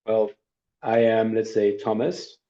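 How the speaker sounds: ordinary talk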